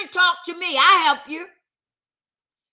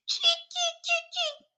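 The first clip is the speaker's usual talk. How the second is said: high mocking "cheek cheek" giggle, much higher; high-pitched imitation of people laughing at you; 4 dB quieter than usual. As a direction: mimic them snickering behind your back. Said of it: "they laughing at you".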